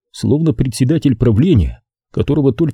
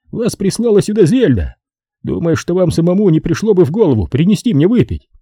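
high-pitched put-upon man; raised character voice, complaining; high, agitated character voice; distraught Brogan; 8 semitones higher than usual. Acted.

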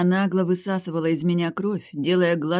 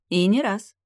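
higher-pitched smirking retort; 5 semitones higher, 4 dB louder than usual. A narrator's reading. Bride promises the groom more bites, smirking. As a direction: add it with a provoking smirk. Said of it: "усмехнулась она".

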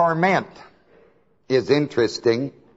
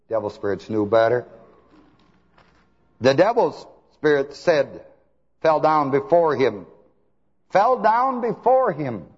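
normal talking voice

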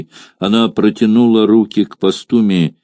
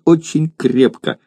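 put-on little girl; 7 semitones higher than usual. Child answers eagerly.

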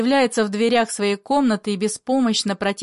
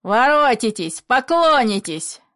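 shouting after them; 4 semitones higher, 5 dB louder than usual. A narrator's reading. Father shouts after those leaving, calling them back. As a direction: call after them, loud and stretched. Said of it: "кричит им вслед".

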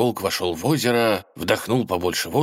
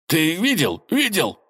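excited; 10 semitones higher than usual. Acted. Wolf jumping for joy.

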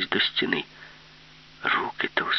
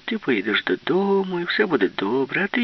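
usual speaking voice

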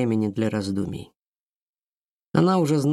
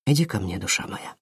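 tender; 5 semitones lower than usual. A narrator's reading. Randall calls Aurora tenderly.

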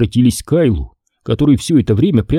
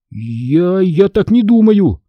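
stammered high protest; stammering; 8 semitones higher, slower than usual. A narrator's reading. Boy disputing the storm explanation, stammering. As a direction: stumble on the first word, then climb. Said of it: "Я снова начал заикаться".

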